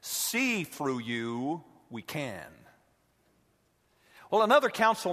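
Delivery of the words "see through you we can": a hint of Yoda. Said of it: "Yoda replies".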